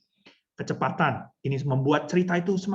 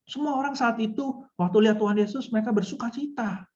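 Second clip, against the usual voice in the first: mock joyless storytelling, pitched up; joyless, burdened; 5 semitones higher than usual. Shown as a demonstration. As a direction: tell of joy as if stressed and weighed down. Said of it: "losonya nggak bersuka cita kayaknya". (bercerita sukacita tapi seperti terbebani)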